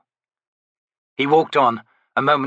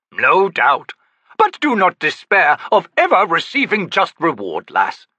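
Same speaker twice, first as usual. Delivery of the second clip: lofty, theatrical, higher; grand old Daru; 8 semitones higher, 6 dB louder than usual. Acted. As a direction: play the eccentric old trickster. Cropper reply.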